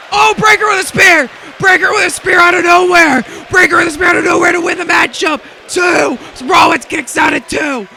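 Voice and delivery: very high, loud shouted call of the spear; screaming call. Real, not acted. Commentator shouting at a surprise spear finish, screaming. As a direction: scream it like the crowd just exploded.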